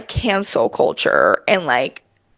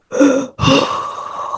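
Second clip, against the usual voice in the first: eerie drawn-out gasp for air; imitated creepy Nosferatu inhale; Count Orlok breath; 3 semitones higher than usual. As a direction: suck in a long, strange breath. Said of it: "He has a very specific voice".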